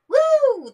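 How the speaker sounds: high, loud "woo" cheer; excited whoop. Real, not acted. Vlogger cheering for a favourite character, excited whoop. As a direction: let out a high, drawn-out whoop.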